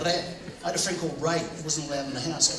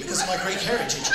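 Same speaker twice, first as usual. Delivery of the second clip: normal talking voice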